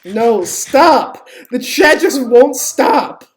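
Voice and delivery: yell, drawn out, fed up with the chat; exasperated yell. Real, not acted. Yelling at chat to stop.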